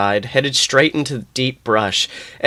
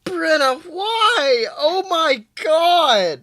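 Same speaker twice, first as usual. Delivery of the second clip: mock despair; 13 semitones higher, slower than usual.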